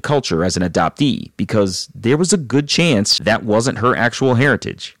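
measured storytelling read over music; steady, composed host narration; documentary narration. Acted. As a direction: narrate the guest's backstory like a podcast intro. Host narration between interview clips.

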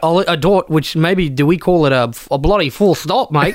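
broad mock Aussie; hammed-up Aussie bloke; Australian accent. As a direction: lay on a thick Australian accent. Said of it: "do letters in Australian".

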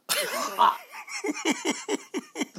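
high pained laugh. Real, not acted. After the rotten candy.

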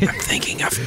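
ASMR whisper; hushed whisper into the mic. Shown as a demonstration. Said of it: "Why are you whispering?".

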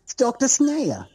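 a bad, slow, high Australian accent; terrible Australian accent. Voicing toy villain Doctor Snare in a clumsy Australian accent. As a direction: try an Australian accent and miss. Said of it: "That's probably a terrible Australian".

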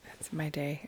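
soft whispered aside; just whisper it. Real, not acted.

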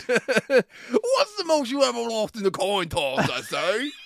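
high, slow, hammed-up English; mock English accent. Bad actor stealing villain's lines, high slow mock English. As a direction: overplay the villain's line as a silly Englishman. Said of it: "with less English accent".

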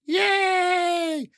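put-on high squeaky cheer of mouse children; kids cheering. Puppet-show children cheering at the story. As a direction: shout it like excited little kids.

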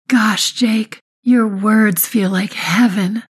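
high, slow, breathless delight; gushing. A narrator's reading. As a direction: swoon over his words. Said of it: "I say".

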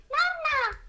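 very high, thin creepy doll calling Mama; son's creepy doll impression. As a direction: imitate a haunted doll crying for its mother. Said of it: "the creepiest imitation of a doll".

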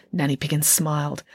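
ordinary delivery

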